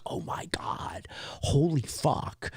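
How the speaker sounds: hushed, breathless whisper; awed whisper. Retold or imitated. Stunned reaction to the ending.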